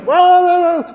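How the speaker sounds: high, loud, wordless frantic howl; agitated howling. Retold or imitated. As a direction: howl over the reading like a panicked patient. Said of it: "she's really upset".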